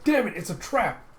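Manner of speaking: high, loud exclamation; alarmed shout. Retold or imitated. Jocko sees metal doors slam down, alarmed shout. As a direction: shout out the danger.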